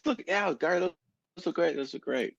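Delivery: high, put-on enthusiastic praise; friend's voice. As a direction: hype up the sunglasses to Gary. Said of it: "then he turns and goes".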